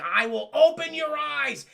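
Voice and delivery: slow, loud, high villain proclamation; villainess declaring. Retold or imitated. Demona about to cast a spell, menacingly grand.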